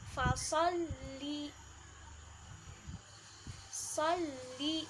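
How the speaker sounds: fasolli slowly, ll held and li short. Shown as a demonstration. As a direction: hold the ll, keep li short. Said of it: "tapi pendek aja".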